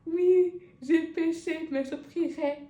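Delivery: lent, plus fort, contrit exagéré; aveu imité d'Antoine. Retold or imitated. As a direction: joue le pénitent. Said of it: "il a dit". (pénitent théâtral mais léger)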